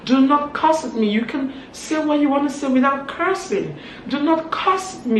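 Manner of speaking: high-pitched, insistent objection; gracious but firm. Retold or imitated. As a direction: imitate someone firmly refusing to be cursed. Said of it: "she was very gracious".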